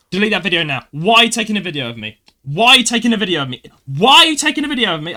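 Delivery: mock indignant rant, building to a high yell; angry demand. Retold confrontation, woman in his face.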